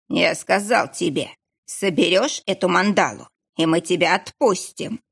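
plain reading voice

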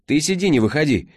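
громко и высоко кричит; крикни строго из кабины; окрик. A narrator's reading. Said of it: "крикнула она Лиле". (кричит Лиле из машины)

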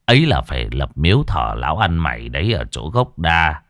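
low, measured voice of a knowing elder; village master. A narrator's reading. Master Trung telling village elders what must be done, low knowing voice.

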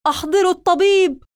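high, loud shout for help. Acted. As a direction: yell for the doctor in panic. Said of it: "وأخذ يصرخ".

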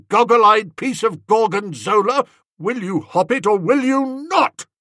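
high, loud exasperated aunt tirade; Aunt Dahlia stirred up. A narrator's reading. Aunt berating the dithering guest, high and loud. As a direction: blast the intruder out of the room. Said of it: "compelling force about the old flesh and blood when stirred".

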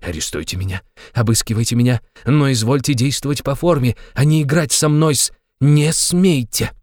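furious defiance, rising steadily; growing rage. A narrator's reading. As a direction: escalate from muted challenge to fury. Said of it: "приходя от этой мысли ещё в большее бешенство".

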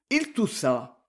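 ordinary delivery

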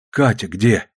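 ordinary delivery, nothing marked

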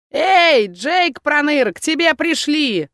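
high loud crone call. A narrator's reading. Crone calling Jake, high loud call. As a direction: holler into the shop.

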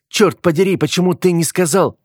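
high-pitched, alarmed female character; shocked reproach. A narrator's reading. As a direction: exclaim it high, in startled reproach. Heroine startled, reproaching hero. (высокий возмущённый возглас)

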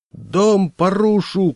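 high, loud, slow rampaging yell; shouting. Acted. Landlord shouting at the family while wrecking walls, high, loud and slow. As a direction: roar it while swinging the crowbar. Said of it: "кричал он".

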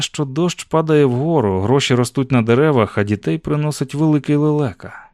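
usual speaking voice

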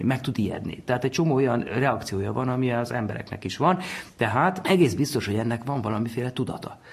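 normal talking voice

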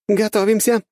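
high, loud female command; shouted battle order. A narrator's reading. Young woman commander as the ships land, shouted order. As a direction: send them charging with a yell. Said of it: "прокричала Чо Сайон".

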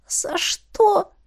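high, tearful, choked question; small, high, strained voice; squeezed-out words. A narrator's reading. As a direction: force it out through hurt. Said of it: "выдавила я".

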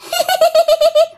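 very high, shrill ghost cackle; ghostly laugh. Acted. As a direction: cackle like a possessing spirit. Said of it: "Bắt đầu nói tới đó cái cười".

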